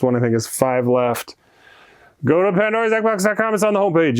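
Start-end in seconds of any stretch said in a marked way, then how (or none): 2.2-4.2 excited plug. Real, not acted. Shop plug, call to action.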